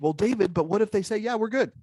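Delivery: high, questioning voice of a doubtful listener; doubtful participant. Retold or imitated. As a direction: play the skeptic raising a worry. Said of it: "you might think".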